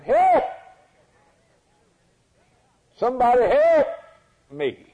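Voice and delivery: loud, high cry for help; raspy, husky cry. Acted. Preacher voicing a leper calling through the jungle, loud husky cry. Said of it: "A raspy, husky voice, but loud voice, crying".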